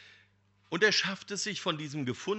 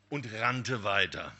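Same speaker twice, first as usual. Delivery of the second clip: ordinary talk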